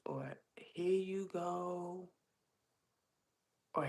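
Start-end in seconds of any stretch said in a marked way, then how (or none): 0.6-2.1 higher, slow mimicked greeting; drawn-out greeting. Pantry lady greeting an arriving regular, drawn-out. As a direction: draw out each word like a greeter.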